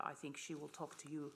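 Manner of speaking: usual speaking voice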